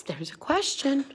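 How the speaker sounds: ordinary talk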